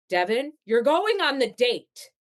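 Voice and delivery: teen sitter bossing the mom, mock stern; bossy babysitter. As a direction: order her out the door. Babysitter orders her to go.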